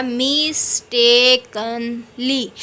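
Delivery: "Mistakenly" slowly in four separate beats; syllable by syllable. Shown as a demonstration. Teacher counting four syllables, "mistakenly" slowly segmented. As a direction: break up "मिस-टे-कन-ली" clearly. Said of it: "इसमें चार आ जाते हैं".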